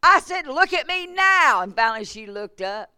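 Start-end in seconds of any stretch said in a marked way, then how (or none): 0.0-1.6 loud, high order, stretched out; commanding shout. Retold or imitated. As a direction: insist forcefully, like a pastor commanding. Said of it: "such a command in his voice".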